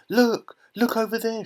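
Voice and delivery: put-on high-pitched little girl's squeal; acted scream of astonishment; excited scream. A narrator's reading. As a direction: shriek it in excitement, without full volume. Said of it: "she screamed".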